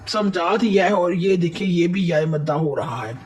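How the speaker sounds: ordinary talk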